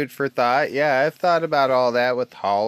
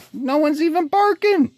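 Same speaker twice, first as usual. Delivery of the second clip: No one's even barking, higher, with disbelief on barking; incredulous higher protest; 11 semitones higher than usual. Real, not acted. Ranting vlogger to viewers, higher disbelieving protest. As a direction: pitch jumps up in disbelief. Insisting the dogs were calm.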